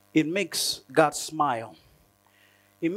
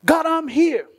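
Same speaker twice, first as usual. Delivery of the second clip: a raised cry for help, reaching upward; loud cry to God; 9 semitones higher, 6 dB louder than usual. Pretend. Worshipper crying to God in prayer, loud and pleading.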